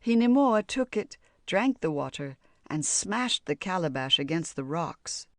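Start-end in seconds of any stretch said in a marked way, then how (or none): none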